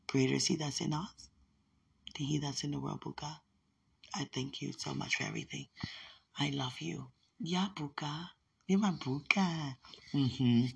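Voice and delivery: soft and tender farewell; tender blessing. Real, not acted. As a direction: bless her gently. Softened loving blessing.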